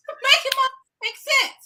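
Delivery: cracking up mid-word, high; laughing. Real, not acted. Laughing at her own rant to her co-host. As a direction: laugh through the words.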